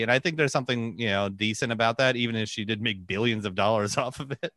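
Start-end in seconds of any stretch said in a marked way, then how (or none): none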